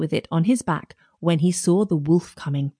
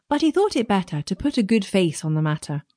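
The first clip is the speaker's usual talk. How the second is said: ordinary delivery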